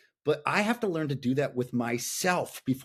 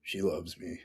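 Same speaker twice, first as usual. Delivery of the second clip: quiet, low voice of a son giving in; reluctant admission; 8 semitones lower, 8 dB quieter than usual. Retold or imitated. Son finally admits it to his prodding dad, quiet and reluctant. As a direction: lightly imitate a kid's soft, grudging admission. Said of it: "keep kinda poking until he says".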